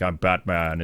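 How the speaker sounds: low-pitched superhero impression; Batman. Telling how Bruce talked to Alfred, low mock-hero voice. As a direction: drop it low, imitating the hero. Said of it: "before he was talking to Alfred all".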